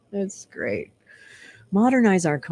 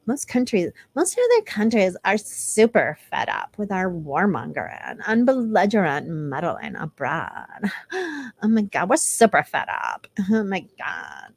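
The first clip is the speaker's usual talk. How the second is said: mocking read. Mocking read of manifesto text.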